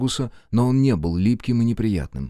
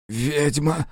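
forced out; 6 semitones higher than usual. Acted. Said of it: "выдавил из себя мужчина".

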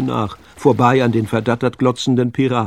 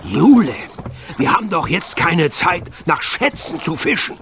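raised, urgent scolding call; 7 semitones higher than usual. A narrator's reading. Sven scolds pig amid the escape, calling out. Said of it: "rief der dicke Sven".

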